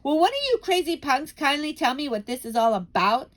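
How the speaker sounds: put-on cheeky boy shouting at the witches. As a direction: bark it at the crowd. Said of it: "shouted Bruno".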